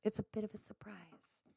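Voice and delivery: hushed whisper; whispered aside. Real, not acted. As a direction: whisper it like a secret. Repeats it as a secret whisper.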